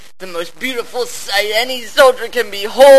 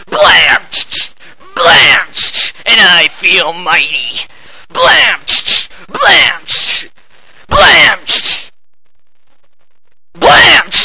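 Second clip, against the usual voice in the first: gun blast sound; 3 dB louder, slower than usual. Acted. Firing shotgun, vocal gun sounds.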